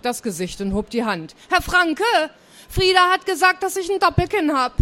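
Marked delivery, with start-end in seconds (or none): 1.5-4.7 high, loud whiny complaint; indignant, raised child's voice; tattling schoolgirl. A narrator's reading. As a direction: tell on a classmate to the teacher. Pupil tells tales to teacher.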